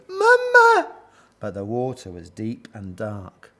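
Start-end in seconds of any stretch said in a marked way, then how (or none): 0.1-0.9 very high, loud cry for mum; shrill little child's distress call; screamed. A narrator's reading. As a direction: squeal like a panicking baby gorilla. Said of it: "screamed Abu".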